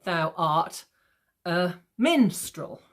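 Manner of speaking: slow, pieced out, hitting "minstrel"; iambic stress. Shown as a demonstration. Teacher trying a Shakespearean insult, slow iambic stress. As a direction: punch the first syllable of "minstrel" after slow lead words. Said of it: "try and do it in iambic pentameter".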